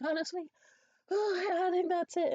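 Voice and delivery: high, loud put-on frailty; feigned distress. Faller agreeing with worried helpers, high fake distress.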